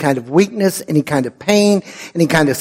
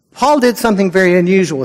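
ordinary talk

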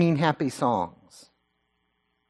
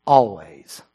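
ordinary delivery

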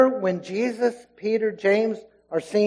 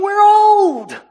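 very high, loud mock wail; incredulous Sarah; 13 semitones higher, 10 dB louder than usual. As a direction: wail it in comic disbelief, voice shooting up. Voicing Sarah's disbelief about old age.

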